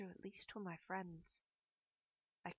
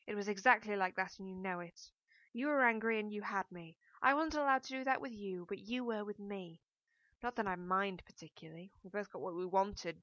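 bitter accusation; 9 dB louder than usual. A narrator's reading. Narrator accuses Michael in a quarrel.

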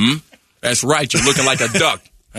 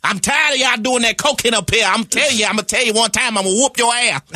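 Madea voice; 12 semitones higher than usual. Acted. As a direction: yell at the kids in the tree like a fed-up grandma. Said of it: "I created Madea".

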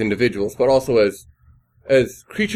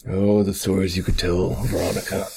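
lower, soft, teasing tone to an object; sultry whisper; 8 semitones lower, 3 dB quieter than usual. Pretend. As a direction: purr it like a lover. Talking to the table.

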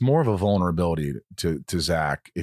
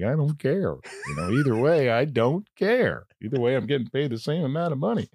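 mock bored bandmate; 7 semitones higher than usual. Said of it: "he goes like".

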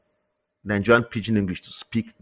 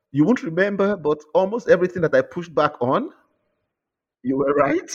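retold confession to editor; 9 semitones higher than usual. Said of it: "I told her that".

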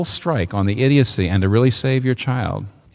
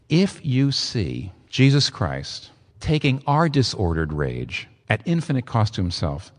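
ordinary talk

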